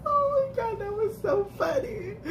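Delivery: high squealing laughter; laughing. Real, not acted. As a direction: gasp it out between laughs. Laughing at the result.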